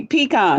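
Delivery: "Pecan" as pee-KAHN; tentative lesson attempt, "Pecan" as pee-KAHN; learner's attempt. Shown as a demonstration. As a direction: try "Pecan" with PEE, then an open KAHN. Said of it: "Y'all teach me".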